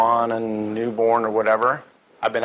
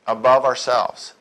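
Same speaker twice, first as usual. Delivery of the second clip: usual speaking voice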